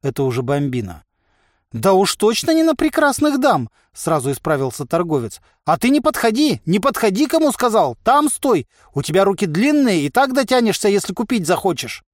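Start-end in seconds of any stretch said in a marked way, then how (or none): 1.7-3.7 high, loud flustered merchant; agitated high-pitched disclaimer; hasty backtracking. A narrator's reading. Merchant hastily corrects himself to Bombina. 5.6-12.0 agitated high-pitched merchant; high, fretful commands; nervous warding off. A narrator's reading. Merchant orders giant to stay back.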